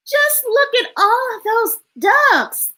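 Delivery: high-pitched storybook wonder; amazed exclamation. Acted. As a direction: marvel aloud in a high character voice. Acts a character in the story.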